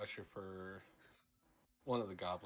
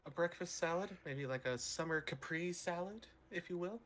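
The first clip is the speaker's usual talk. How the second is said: higher, hesitant dainty ordering; lightly voiced polite character; 7 semitones higher than usual. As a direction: order politely in a slightly raised, prim voice.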